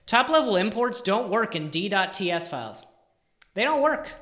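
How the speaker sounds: loud, exasperated complaint, rising on work; frustrated rant. Real, not acted. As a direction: vent loudly at the broken imports. Frustrated coder ranting at screen.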